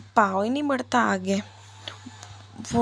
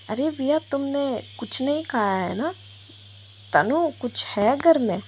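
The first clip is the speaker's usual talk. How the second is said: bright higher voice asking casually; cheerful friend; 5 semitones higher than usual. Acted. Komal asks Viya about food in a higher voice. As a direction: Komal checks if Viya ate, higher-pitched and chirpy.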